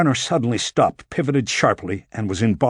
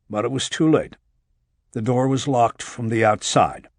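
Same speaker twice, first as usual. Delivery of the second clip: usual speaking voice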